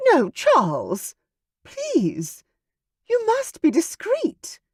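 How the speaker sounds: high pleading cry, slowing at first; wife begging Charles, rising into a plea. A narrator's reading. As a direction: beg him desperately.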